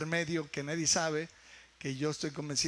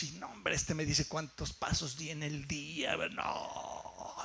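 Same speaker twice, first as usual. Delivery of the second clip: imitating someone reverently wowed, fading softer; mock awe; 3 dB quieter than usual. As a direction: marvel in mock worship, stretching the words. Mimics people awed by gadgets.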